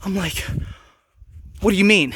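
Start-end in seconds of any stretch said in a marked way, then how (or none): 1.6-2.1 indignant retort. Retold or imitated. Arguing with supervisor.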